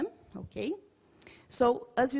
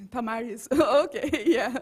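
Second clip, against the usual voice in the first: laughing; 6 dB louder than usual. Real, not acted. Laughing at colleague's remark.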